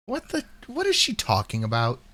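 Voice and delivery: ordinary talk, nothing marked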